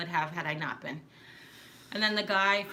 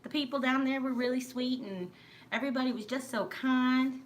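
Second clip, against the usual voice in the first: lilting mock Georgia accent, higher than usual; 5 semitones higher than usual. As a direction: imitate a sweet Georgia accent, pitched up. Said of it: "talking just like".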